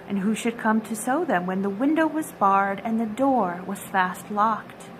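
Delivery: usual speaking voice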